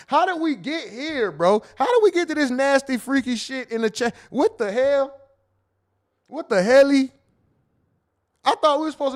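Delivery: high, loud exclamations of mock outrage; loud shocked outburst. Real, not acted. Streamer reading freaky chat messages, loud shocked outrage. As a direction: shout it, scandalized by the chat.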